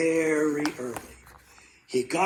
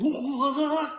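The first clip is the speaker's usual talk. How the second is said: high, drawn-out wobbly yelp; crushed cry; 12 semitones higher than usual. A narrator's reading. Moose lands on father, high crushed cry. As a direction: let out a squashed high wail.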